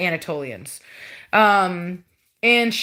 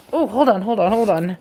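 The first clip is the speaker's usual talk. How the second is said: ordinary talk, nothing marked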